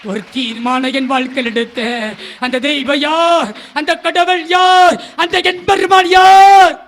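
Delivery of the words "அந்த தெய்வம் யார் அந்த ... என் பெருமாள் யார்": louder and louder preaching climax; full-force preaching. Acted. Preacher crying out to the congregation, building to a shout. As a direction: build it into a thundering sermon cry.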